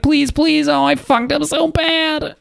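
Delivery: high, slow, whiny mock plea; mock begging. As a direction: play the captured pilot begging, hammed up. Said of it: "he's meanwhile just captured it being like".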